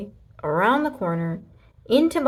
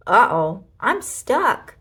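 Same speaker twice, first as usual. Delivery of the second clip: stuck; 2 semitones higher, 7 dB louder, slower than usual. A narrator's reading. Trixie stuck under bed.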